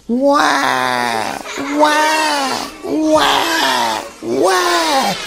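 high, drawn-out mock baby cries; imitated baby wail. A narrator's reading. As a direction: wail like a comic baby, wah after wah. Storyteller imitates the crying baby.

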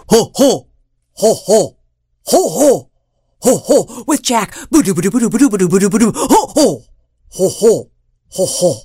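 stomp the giant's stride; giant's steps. A narrator's reading.

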